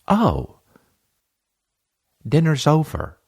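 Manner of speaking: high, stunned "oh", then dinner's over; shocked deadpan verdict. Retold or imitated. Comic reacting to his date's confession, stunned and high.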